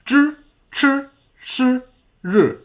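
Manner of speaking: Z, Ch, Sh, R with tongue curled back; curled tongue heavy consonants. Shown as a demonstration. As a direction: roll the tongue up for Z, Ch, Sh, R. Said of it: "rolling up to form that er".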